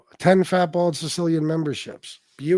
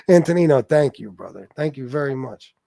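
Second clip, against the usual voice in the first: normal talking voice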